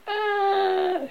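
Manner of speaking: high scream held back in volume; high wail as if far away; thin, sustained high scream; distant scream. A narrator's reading. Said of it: "Distant scream".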